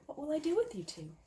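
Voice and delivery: lightly voiced fussing caretaker; worried shelter lady. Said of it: "said Missus Barrett".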